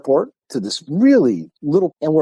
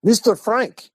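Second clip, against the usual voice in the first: high-pitched caller trying once more; insistent caller; 10 semitones higher, slower than usual. Retold or imitated. Caller phones back after a hang-up, high insistent query. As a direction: pitch the name up sharply, pressing for an answer.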